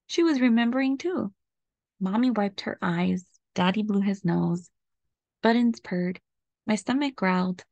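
usual speaking voice

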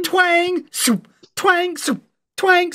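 high-pitched twang-and-whoosh noises, mouthed; arrow twangs. Retold or imitated. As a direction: mimic arrows flying past, lightly. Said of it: "you hear like".

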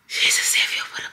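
gasping whispers, winded from the stairs; barely any air left after climbing the stairs; breathless whisper. Real, not acted. Out of breath after her stairs, breathless whisper. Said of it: "I can't even talk".

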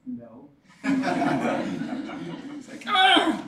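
usual speaking voice

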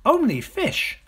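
high, loud little-croc voice; objecting smaller crocodile. Acted. Smaller crocodile refusing to eat children, high and indignant.

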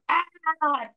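high, drawn-out mock "aah"; overwhelmed mind cry. Teacher acting out a mind full of noise, mock overwhelmed cry. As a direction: let out a long, high cry of overload. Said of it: "our mind just like".

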